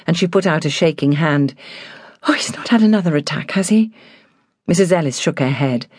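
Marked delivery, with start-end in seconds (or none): 2.3-3.9 fearful. A narrator's reading. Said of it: "A wave of fear swept over her".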